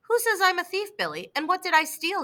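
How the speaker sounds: high, puzzled disbelief; teacher asking the boy who calls him a thief, startled and confused; startled confusion. Acted. A touch of shocked disbelief. Peabody hears he's called a thief.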